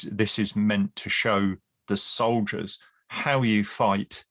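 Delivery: usual speaking voice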